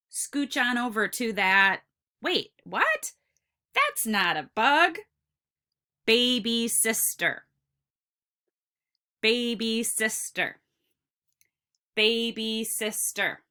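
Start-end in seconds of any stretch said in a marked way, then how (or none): 2.2-5.0 puzzled double take, pitch jumping up; playful, high-pitched disbelief; mock surprise. Mock surprise at baby sister. 6.1-13.4 put-on Baby sister in four even beats; Baby sister chanted evenly, syllable by syllable. Shown as a demonstration. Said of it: "tap each syllable".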